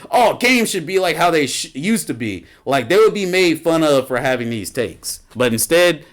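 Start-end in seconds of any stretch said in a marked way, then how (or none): 0.1-2.4 higher mock voice of a nostalgic gamer, lightly done; mocking whiny gamer. Mocking nostalgic gamers online, a lightly higher whiny mock voice. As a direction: lightly parody a gamer complaining. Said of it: "to say".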